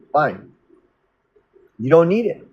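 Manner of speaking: usual speaking voice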